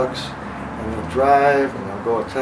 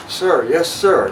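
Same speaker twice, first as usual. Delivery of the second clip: loud, higher snapped reply; recruit's sir yes sir; 5 semitones higher, 8 dB louder, slower than usual. Retold or imitated. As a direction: answer like a drilled recruit. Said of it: "I'm like".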